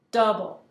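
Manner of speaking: "DOUble" hit loud on the first syllable; "double" as a loud trochee, strong then weak; stressed syllable first. Shown as a demonstration. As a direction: slam "dou" in "double", let "ble" fall. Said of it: "the stressed syllable now is coming first".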